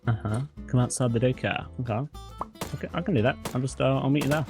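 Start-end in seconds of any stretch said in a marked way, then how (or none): none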